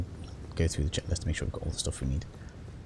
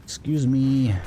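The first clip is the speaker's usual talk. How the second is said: loud, high polite excuse me; coming through shout; 8 semitones higher, 5 dB louder, much slower than usual. Pretend. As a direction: call out to enemies as you pass. Sneaking past enemies, talking to them.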